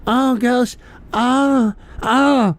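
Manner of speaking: mock a swooning fan, lightly; imitating a commenter with a hint of a swoon; mocking effeminate voice. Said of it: "that's why you talk like".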